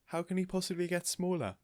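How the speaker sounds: a light worry; worried Mrs Silver. A narrator's reading.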